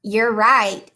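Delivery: ordinary delivery, nothing marked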